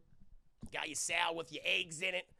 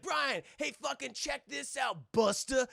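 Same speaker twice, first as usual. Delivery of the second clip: taunting shout; 6 semitones higher, slower than usual. Retold or imitated. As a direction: show off and mock him. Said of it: "it's like".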